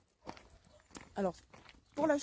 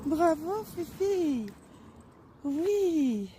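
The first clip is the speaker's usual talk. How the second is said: talking to a dog; 7 semitones higher, 6 dB louder, much slower than usual. Real, not acted. Praising the puppy.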